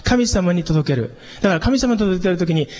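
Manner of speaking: ordinary delivery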